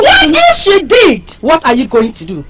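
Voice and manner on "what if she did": slow, loud, higher dare; provoking challenge. Acted. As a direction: taunt her to act. Defending friend, provoking rival.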